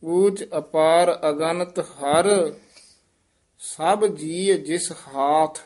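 ਅਗਨਤ with a full ਅ at the start; clear ਅਗਨਤ. Shown as a demonstration. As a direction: sound the ਅ of ਅਗਨਤ fully. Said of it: "ਅਗਨਤ ਉਚਾਰਨ ਕਰਨਾ ਹੈ". (ਅਗਨਤ ਪੂਰਾ ਉਚਾਰੋ)

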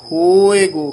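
"ਹੋਏਗੋ" said loud and set apart; stressed pronunciation. Shown as a demonstration. Teacher stresses ਹੋਏਗੋ to show how it is pronounced. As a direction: punch ਹੋਏਗੋ out on its own. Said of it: "ਗੋ ਤੋਂ dash ਦੇ ਦੇਣੀ ਹੈ".

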